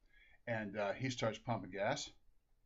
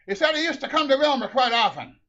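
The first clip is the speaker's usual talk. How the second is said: loud, raised-pitch answer; shouted relay; 11 semitones higher, 12 dB louder than usual. Acted. Husband reports to wife in the car, loud high shout. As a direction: shout it back to her.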